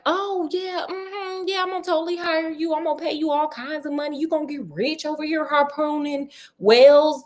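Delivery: butter him up with a phony grin in the voice; fake friendly pitch. Sherlock luring the harpooner into a trap, fake-sweet and fast.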